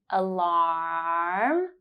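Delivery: "Alarm" with the ah held three seconds. Shown as a demonstration. Teacher holding the ah in "Alarm" for learners. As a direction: stretch the ah in "Alarm" long. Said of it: "hold ah for three seconds for the first time".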